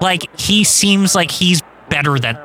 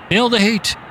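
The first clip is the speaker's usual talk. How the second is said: higher hyped announcer voice; raised pitch announcer catchphrase; Tony Schiavone impression; 7 semitones higher than usual. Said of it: "Tony Schiavone keeps saying that".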